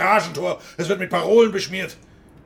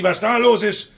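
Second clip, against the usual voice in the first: usual speaking voice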